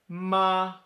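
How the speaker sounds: "ma" held high and level; first tone. Shown as a demonstration. Teacher demonstrating ma for mother, high level tone. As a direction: hold "ma" on one high pitch. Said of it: "let's do them all together".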